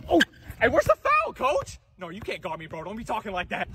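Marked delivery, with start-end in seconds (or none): 0.1-1.8 high, loud complaint to the coach; foul protest. Acted. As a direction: yell in disbelief at a missed call.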